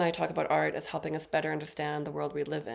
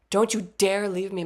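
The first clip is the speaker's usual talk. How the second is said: louder, emphatic mock demand; mock pleading call; 3 semitones higher, 7 dB louder than usual. Sleepless mother calling back the fading voice, louder mock pleading. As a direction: call out as if stopping someone leaving.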